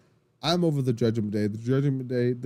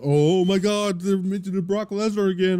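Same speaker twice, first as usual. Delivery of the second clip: higher, louder, mocking the eye-rolling fans; hammed-up exasperated moan, pitched up; mock fan whine; 7 semitones higher, 3 dB louder than usual. Complaining fans groaning about another Brock Lesnar mention, mock exasperated whine. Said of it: "some people are gonna be like".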